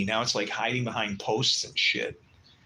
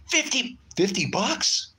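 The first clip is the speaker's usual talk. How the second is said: stunned disbelief; 13 semitones higher, 4 dB louder, slower than usual. Retold or imitated. Buyer stunned by low price.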